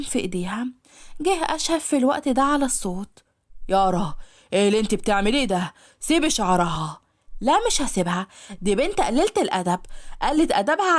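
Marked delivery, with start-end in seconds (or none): 3.7-7.0 low, firm man telling her to let go; a lowered voice commanding her to stop; stern master's voice. Acted. Ashhab orders Yara to let go, low and stern.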